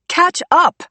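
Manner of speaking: high loud shout while running. A narrator's reading. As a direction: shout it back over your shoulder. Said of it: "I shouted back".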